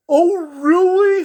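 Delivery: a lonely clown pleading for company, up high; high clown voice, tearful at the end; sad clown voice. Acted. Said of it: "said in his clown voice".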